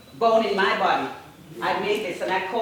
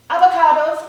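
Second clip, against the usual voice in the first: excited; 9 semitones higher, 11 dB louder, faster than usual. Real, not acted. Punchline of a comic reveal.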